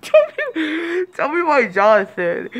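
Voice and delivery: high wheezing laugh-cry; tears of laughter breaking the words. Real, not acted. Vlogger losing it over cousin Jonathan, crying laughing.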